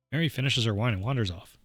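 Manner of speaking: ordinary delivery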